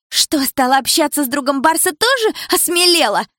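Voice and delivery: high, loud mocking jab; shouted taunt. A narrator's reading. Milana taunting Dilara in the hair-pulling fight, shouting. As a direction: sneer at her as you fight.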